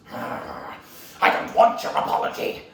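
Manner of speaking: slow, loud snarl building up; angry villain. Acted. Villain rejects apology and demands scroll, angry. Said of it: "he goes".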